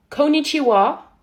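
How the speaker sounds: loud, drawn-out Japanese greeting in an American accent; accent américain. Shown as a demonstration. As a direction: shout it slowly like an exaggerated American. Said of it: "Faut faire avec un, un accent américain". (gros accent américain, fort et traînant)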